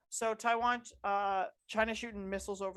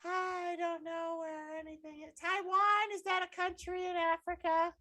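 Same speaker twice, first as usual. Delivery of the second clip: put-on high confused old lady; 9 semitones higher than usual. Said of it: "She's like".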